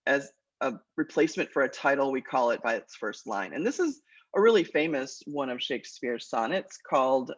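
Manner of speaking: ordinary delivery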